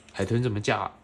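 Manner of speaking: ordinary delivery, nothing marked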